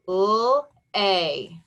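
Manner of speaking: "L-A" as separate sounds, held lll then long ay; sounded out. Shown as a demonstration. Teacher tapping out the first syllable, "L-A" segmented into lll, ay. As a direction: hold each sound of "L-A" on its own. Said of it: "First syllable".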